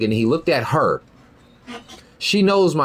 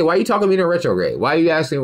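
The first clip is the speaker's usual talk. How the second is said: normal talking voice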